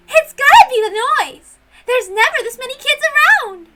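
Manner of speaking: high, loud and thrilled; bouncing with excitement; excited kid. Acted. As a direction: burst out with childish glee. Kid excited about family conference.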